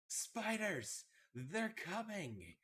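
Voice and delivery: high, drawn-out warning cry; yelling back. Acted. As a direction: call out a warning, stretching the words. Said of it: "I'm going to yell back to them".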